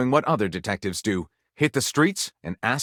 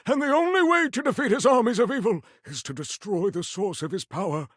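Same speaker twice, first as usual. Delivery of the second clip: pleading wizard; 6 semitones higher than usual. A narrator's reading. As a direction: beg for the hero's help. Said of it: "Gondorf pleaded".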